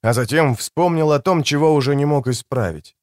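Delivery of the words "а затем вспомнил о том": plain reading voice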